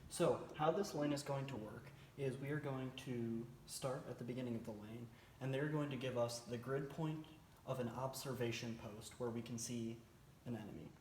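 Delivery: ordinary talk, nothing marked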